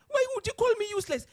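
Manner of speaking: high, loud mock wail; retold tearful protest. Humiliated executive confronting the chairman, tearfully protesting. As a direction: imitate an upset woman's indignant cry. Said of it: "Then you burst into tears".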